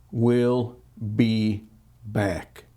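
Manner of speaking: "we'll", "be", "back" with pauses between, fading; slow spaced words. Shown as a demonstration. Quoting the newspaper, "we'll be back" word by word. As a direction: drop each word "we'll be back" separately, trailing off. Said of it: "Out of twenty minutes".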